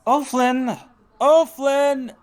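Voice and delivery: insistent high-pitched holler; calling out. Acted. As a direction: shout his name across a balcony. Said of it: "another voice speaks up".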